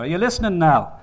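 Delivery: furious husband's challenge; angry challenge. Acted. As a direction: demand it, voice rising in anger. Angry father confronts the mother.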